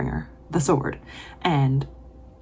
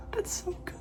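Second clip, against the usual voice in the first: high breathless whisper; whispered awe at a great line; awed whisper; 14 semitones higher, 13 dB quieter than usual. Real, not acted. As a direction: barely voice it, overcome. Awed reaction.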